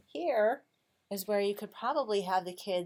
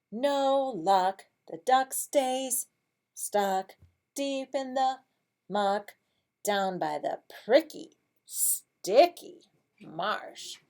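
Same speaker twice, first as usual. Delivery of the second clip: slow, loud, rhythmic story voice; picture-book reading; 5 semitones higher, 6 dB louder, much slower than usual. Shown as a demonstration. Teacher reads the duck staying stuck, slow emphatic storybook voice. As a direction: stretch each phrase for the kids.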